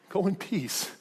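hushed, lightly mocking repeat; incredulous echo. Preacher echoing the jailer's words to beaten prisoners, quietly incredulous. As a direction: echo it softly, as if it were absurd.

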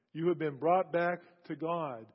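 ordinary talk, nothing marked

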